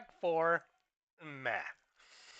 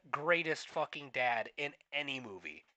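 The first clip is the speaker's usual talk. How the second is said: ordinary delivery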